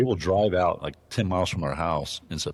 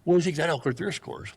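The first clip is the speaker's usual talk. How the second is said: higher imitated voice, baiting; mock challenge; 10 semitones higher than usual. Lodge owner quizzing the narrator about his elk, mock high. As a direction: lay a trap with a smug question. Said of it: "He's like".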